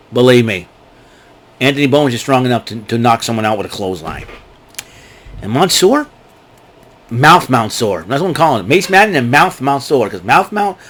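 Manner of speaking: ordinary talk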